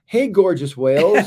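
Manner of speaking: laughing greeting. Real, not acted. Greeting viewer in chat.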